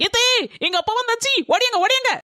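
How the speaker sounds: high-pitched excited summons; excited calling out. Acted. Daughter announces her father's arrival to the household, excited high call. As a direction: call everyone over, voice pitched right up.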